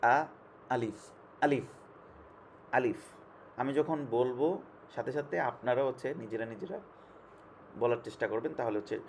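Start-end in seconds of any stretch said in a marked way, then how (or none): 0.0-3.0 আলিফ said with a hard glottal start; teacher modelling a firm আলিফ; firm alif demo. Shown as a demonstration. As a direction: drill আলিফ slowly for the class. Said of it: "সেটাকে শক্ত করে আলিফের উচ্চারণটা হবে যেমন আলিফ".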